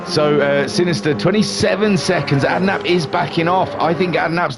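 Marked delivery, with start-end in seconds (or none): none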